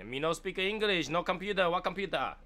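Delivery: high, loud broken-English put-on accent; crazy put-on accent. Prank on advertisement caller, crazy put-on accent. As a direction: play clueless in a hammed-up foreign accent. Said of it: "like, accents".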